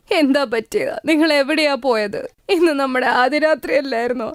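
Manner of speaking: sobbing. Acted. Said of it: "ഏങ്ങി ഏങ്ങി കരഞ്ഞോണ്ടേയിരുന്നു".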